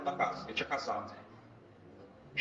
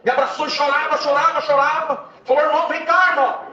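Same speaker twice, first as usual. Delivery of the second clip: much higher and louder, shouting the testimony; preaching at full force; 9 semitones higher, 7 dB louder than usual. Acted. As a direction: drive the story home to the congregation, shouting. (pregação gritada com fervor)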